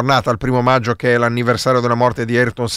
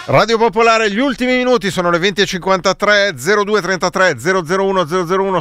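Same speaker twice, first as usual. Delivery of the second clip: bright, raised on-air announcer read; radio announcer; 4 semitones higher than usual. Acted. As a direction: announce the station and number. Station ID and phone number read.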